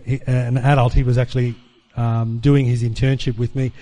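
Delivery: ordinary talk, nothing marked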